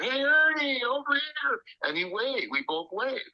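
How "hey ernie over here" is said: high, drawn-out holler across a ballpark; a long, high-pitched "hey" to get his attention; yelling out. Retold or imitated. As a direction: call out to someone far off, pitched way up. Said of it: "Phil yells out".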